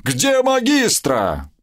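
high, slow mock battle cry; habitual bellow. Somov yells his famous film line after winning, bellowing. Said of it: "привычно заорал".

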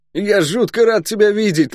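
high, excitable soldier greeting a friend; high-pitched, exuberant guardsman voice; boisterous guardsman, delighted. Acted. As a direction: pipe it up in joyful surprise. Guard Luk greets his friend.

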